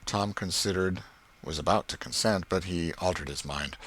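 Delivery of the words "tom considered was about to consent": usual speaking voice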